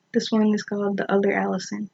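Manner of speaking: ordinary talk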